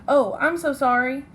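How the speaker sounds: ordinary talk, nothing marked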